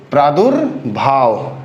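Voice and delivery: प्रादुर्भाव drawn out, with a half r after प्र and after दु; slow careful pronunciation. Shown as a demonstration. Teacher showing students each sound, slow careful pronunciation. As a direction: spell out प्रादुर्भाव slowly, sound by sound. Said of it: "फिर र का sound आएगा".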